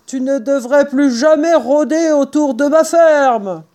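high-pitched angry farmer's warning; yelled warning. A narrator's reading. Boggis hurle au renard. (avertissement crié)

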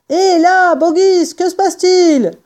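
high, loud onlooker's call. A narrator's reading. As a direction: shout a question down into a pit. Badauds appelant du bord du cratère. (interpeller de loin en criant)

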